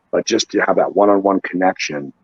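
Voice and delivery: ordinary talk, nothing marked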